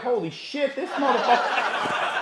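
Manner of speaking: high, hushed amazement; imitated shocked onlookers. Concertgoers spotting a famous man fighting, imitated amazement. Crowd reacting to the slap.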